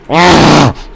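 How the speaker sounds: high, loud yell; short high-pitched scream; sudden scream. Real, not acted. Yells out while playing.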